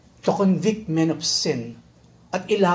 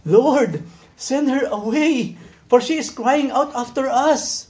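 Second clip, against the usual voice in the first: pleading disciples; 6 semitones higher, slower than usual. Acted. Disciples voiced begging Jesus.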